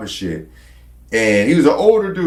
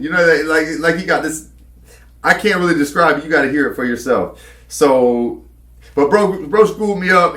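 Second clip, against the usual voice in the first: normal talking voice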